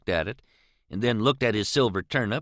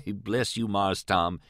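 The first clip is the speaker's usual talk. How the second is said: plain reading voice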